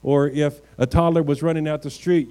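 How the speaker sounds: normal talking voice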